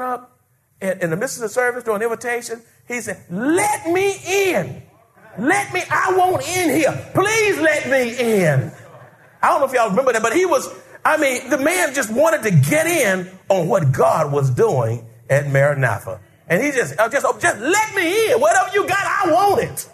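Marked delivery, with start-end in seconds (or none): 3.3-8.7 high, slow begging to be let in; high pleading voice, drawn out; pleading plea. Retold or imitated. Man pleading to get into church. 17.3-19.9 high shouted plea; begging shout. Retold or imitated. Man begging to join.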